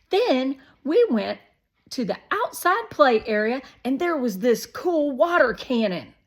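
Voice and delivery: put-on excited kid Shake. A narrator's reading. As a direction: rattle it off like a wound-up kid. Narrator voices Shake's long story.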